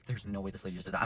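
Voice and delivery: hushed, low disbelief; quietly dumbfounded; stunned. Retold or imitated. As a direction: drop low and soft as if stunned.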